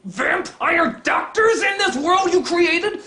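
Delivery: high-pitched exasperated outburst; incredulous, high ranting; outraged yell. Real, not acted. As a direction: scream it at the author in disbelief. Outraged rant at the story.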